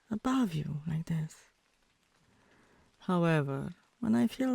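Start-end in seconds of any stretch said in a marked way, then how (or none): 0.5-1.4 soft teasing whisper. Acted. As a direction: let it fall to a breath. Teasing from above him.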